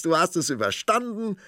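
high, eager exclamation; excited calling. A narrator's reading. Prince to princess, relieved, high and excited. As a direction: still riding the cheer. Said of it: "rief der Prinz".

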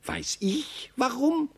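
high, exasperated doe voice; impatient doe. Acted. Karla tells Maxel to try the ice himself, high and impatient. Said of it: "erwiderte das Reh".

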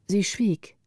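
ordinary delivery

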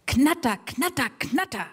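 chopped "Knatter" like a rattling engine; imitating a clattering lawnmower; mower rattle imitation. Shown as a demonstration. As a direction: rattle the words out like a motor.

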